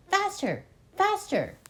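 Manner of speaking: high urgent shouts; shouting. Acted. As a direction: drive them on, yelling.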